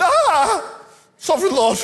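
high, loud, hammed-up cry of protest; dismayed wail. Shown as a demonstration. Preacher acting Jeremiah answering God's call, hammed-up dismayed wail. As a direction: wail it out in mock despair at God. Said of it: "I think he went".

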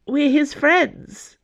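high, slow, helpless plea. A narrator's reading. Friend explaining herself to the nuns, helpless. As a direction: plead helplessly, pitched well up. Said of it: "Dana said helplessly".

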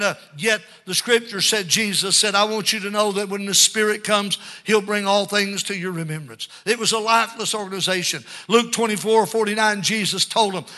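ordinary talk, nothing marked

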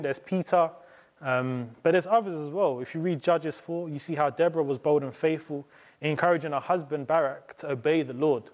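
ordinary talk

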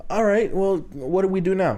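high-pitched retold exasperation; exasperated. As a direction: act out being at a loss with the clerk. Acts out his exasperated reply to clerk.

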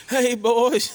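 high, loud greeting in dad's voice; hollering a cheerful hello. Retold or imitated. Imitating dad on golf cart.

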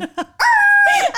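very high, loud screech; piercing mock car-stop noise; imitated tire screech. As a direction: squeal like brakes slammed on.

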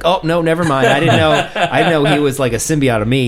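loud mock panic, laughing through it; mock-panicked Venom. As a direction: play Venom chickening out, half laughing. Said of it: "he's like".

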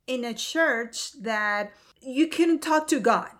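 ordinary talk

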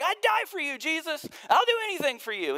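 high, loud, gushing overeager vow; breathless high-pitched devotion; eager vow. Retold or imitated. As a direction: blurt it out like a starstruck fan. Peter's overeager vow to Jesus.

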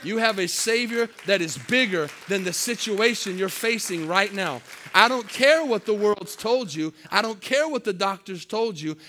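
loud, building preacher's cry; preaching at full force. Acted. Pastor rallying the church against their problems, loud building preach. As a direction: drive each line harder to the church.

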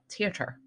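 "Theater" with a hard T, tee-uh-ter; Irish T for TH. Shown as a demonstration. Showing co-host the Irish show's pronunciation, hard T. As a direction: say the TH of "Theater" as a plain T. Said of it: "they say the T in front of the T H".

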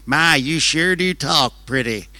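lightly voiced slow Southern church lady, laughing; a hint of a sweet old Pentecostal lady. Pentecostal lady charmed by the Kiwi preacher, slow and amused. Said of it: "And they said".

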